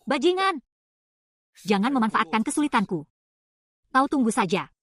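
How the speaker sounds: furious at being cornered; angry outburst. Acted. Insulting the blackmailer.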